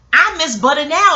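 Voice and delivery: high, loud lament rising at the end; emotional. Real, not acted. Lamenting butter while still in this chubby body, emotional. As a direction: cry it out with exaggerated longing. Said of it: "I'm about to get emotional".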